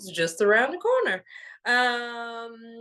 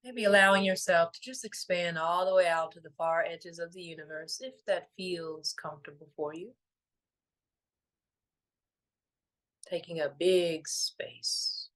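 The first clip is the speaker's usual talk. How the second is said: guided meditation; 3 semitones lower than usual. Real, not acted. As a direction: gently guide them into stillness, letting each phrase settle.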